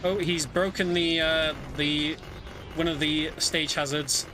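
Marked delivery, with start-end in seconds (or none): none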